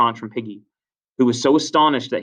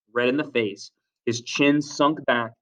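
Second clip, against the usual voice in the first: usual speaking voice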